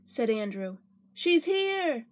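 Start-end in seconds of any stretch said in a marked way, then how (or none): 1.1-2.1 put-on high, gleeful kid voice; happy child's call, pitched high; excited child. A narrator's reading. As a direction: announce an arrival like a delighted child. Child cheers at sitter's arrival.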